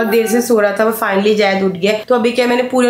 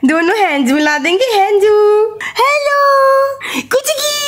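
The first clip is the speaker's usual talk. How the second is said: squeaky high coochy-coo; 13 semitones higher than usual. Real, not acted. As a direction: play with an infant in a very high voice. Mother playing with her baby.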